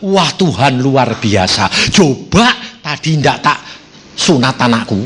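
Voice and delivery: a mother marvelling at God; awed relief. Retold or imitated. Zipporah voiced in awe. (kagum pelan dan rendah)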